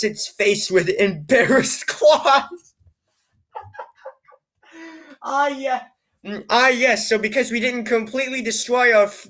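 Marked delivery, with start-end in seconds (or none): none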